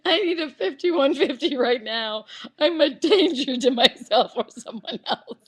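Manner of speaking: normal talking voice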